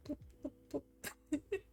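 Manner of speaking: slow, soft lip-popping boops; quiet high lip sounds for a baby. Shown as a demonstration. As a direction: pop the lips gently like for a baby. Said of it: "do the lip thing".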